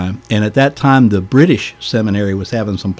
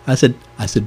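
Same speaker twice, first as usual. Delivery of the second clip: ordinary talk, nothing marked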